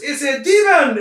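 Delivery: high, loud alarm shout; alarmed guard's cry. Acted. Elf guard draws his blade at the intruder, alarmed shout. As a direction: raise the alarm at full voice.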